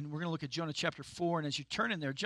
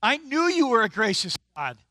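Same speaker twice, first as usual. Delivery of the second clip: loud, higher, exasperated complaint; frustrated; 6 semitones higher, 11 dB louder than usual. Retold or imitated. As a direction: spit it out like an annoyed prophet. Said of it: "notice how he's frustrated".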